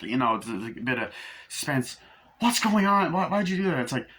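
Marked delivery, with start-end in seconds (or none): 2.4-3.8 upset demand. Retold or imitated. Mother confronting the maid, retold.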